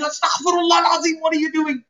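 high-pitched, rushed cry of reproach; shocked scolding mother. Acted. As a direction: mum catching you out from the kitchen. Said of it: "and your mother, mashallah, is in the kitchen".

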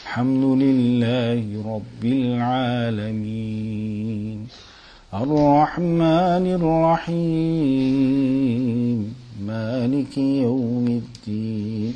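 mournful Quran recitation; sad recitation. Shown as a demonstration. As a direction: recite with sorrow in the melody. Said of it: "someone sad ... read Fatiha in that way".

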